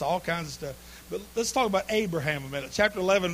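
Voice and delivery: ordinary talk, nothing marked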